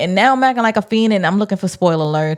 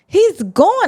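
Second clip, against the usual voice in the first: excited; 11 semitones higher than usual. Retold or imitated. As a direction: yelp it out in panic. Reacting as the dragon appears.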